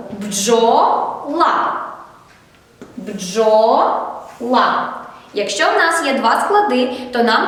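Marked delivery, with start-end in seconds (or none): 0.1-1.7 «Бджола» in two drawn-out syllables, бджо, then ла; split into syllables. Shown as a demonstration. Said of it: "Як ми поділимо?". 3.0-4.9 «Бджола» slowly divided as бджо, pause, ла; split into syllables. Shown as a demonstration. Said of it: "Як ми поділимо?".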